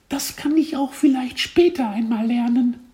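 very high, squeaky little voice; cartoon kid voice. A narrator's reading. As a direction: speak like a cheeky tiny caterpillar. Caterpillar answers teacher.

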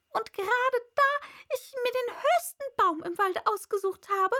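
high, loud bird voice, ranting; complaining pigeon. A narrator's reading. Pigeon ranting to Alice, high and loud. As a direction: rattle off the grievances, shrill bird.

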